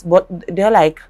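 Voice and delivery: ordinary delivery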